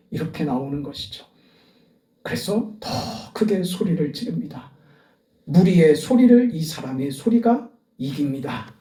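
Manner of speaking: ordinary delivery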